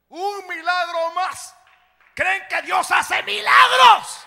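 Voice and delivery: high, loud, slow cry to the church; preaching shout. Real, not acted. Preacher shouting to the congregation about miracles. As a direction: thunder the question out.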